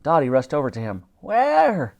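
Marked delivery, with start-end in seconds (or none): 1.2-2.0 alarmed question. A narrator's reading. Dottie rushes over.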